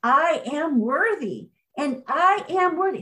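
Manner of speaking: rising, fervent I am worthy; believing affirmation. Shown as a demonstration. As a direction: say it like you truly believe it. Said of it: "And then you got to believe it".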